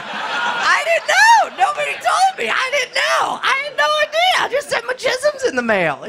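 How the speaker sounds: very high, wailing self-defense; hammed-up frantic protest. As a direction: plead innocence in a comic squeal. Acted frantic excuse for embarrassing mistake.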